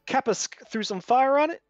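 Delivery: high, loud storytelling brag; proud tale. Acted. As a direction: boast with relish. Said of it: "He points over to goes".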